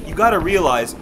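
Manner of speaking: usual speaking voice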